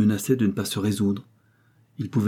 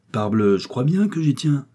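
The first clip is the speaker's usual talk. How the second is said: gruff, raised and higher exclamation; 5 semitones higher, 4 dB louder than usual. A narrator's reading. Manufacturer insisting on his honour, gruff outburst. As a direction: bluster it out gruffly. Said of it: "d'un ton bourru".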